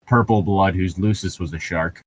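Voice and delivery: normal talking voice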